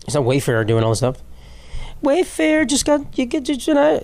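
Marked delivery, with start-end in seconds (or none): 2.0-4.0 high, drawn-out squeaky stumble; fumbling English. Real, not acted. Host losing his words mid-sentence, high drawn-out fumble. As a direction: stretch the words up high as speech falls apart. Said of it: "Can't speak English".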